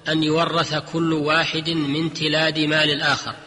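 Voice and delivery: ordinary delivery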